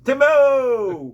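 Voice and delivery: loud cheerful call. Real, not acted. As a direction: call out a greeting. Greeting Tim calling out.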